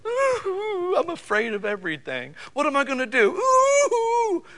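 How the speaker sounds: high, whiny mock-frightened voice; mock fearful whimper. Preacher mocking a frightened woman for the ladies, high whiny whimper. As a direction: ham up a helpless scared wail. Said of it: "I'm not saying emotions are always like".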